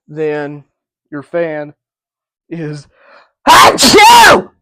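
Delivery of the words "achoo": big, high, explosive sneeze; loud sneeze. Real, not acted. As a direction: sneeze hard mid-sentence. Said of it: "I sneezed my head off".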